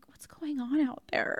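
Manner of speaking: soft unvoiced whisper. Retelling windy night fear, whispered. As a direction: breathe it like you're nervous at night. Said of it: "but I was just like".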